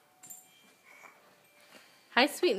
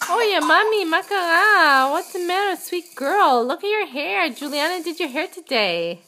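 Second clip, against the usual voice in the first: higher, louder cooing; 5 semitones higher, 4 dB louder than usual. Real, not acted. Mother cooing to baby girl.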